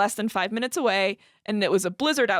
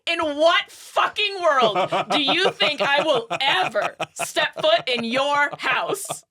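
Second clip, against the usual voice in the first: higher, slow, emphatic mock outrage; acted inner scream; 4 semitones higher, 3 dB louder, slower than usual. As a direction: hammer out each word like a scream in your head. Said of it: "screaming in my head".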